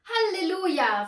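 loud, high cry of joy; jubilant. A narrator's reading. Angel rejoicing at a free wish, jubilant cry. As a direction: rejoice at full voice. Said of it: "frohlockte Aurelius".

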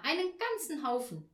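put-on high little girl; eager child. Tina continues.

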